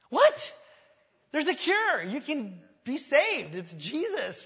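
very high, loud cry easing down in pitch; emotional outburst. Acted. Preacher answering objectors about salvation, overwhelmed excited shouting. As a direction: explode in disbelief, then stretch it out emphatically. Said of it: "getting a little emotional there".

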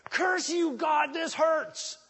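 loud, high shout at God; mock fist-shaking tirade; angry cursing. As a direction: cry out in angry protest, drawing out the pain. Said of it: "Shaking their fist at God".

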